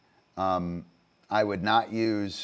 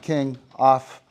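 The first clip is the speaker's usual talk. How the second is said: loud, higher command to the dog; firm command; 6 semitones higher, 10 dB louder than usual. Real, not acted. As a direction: call the dog off sharply.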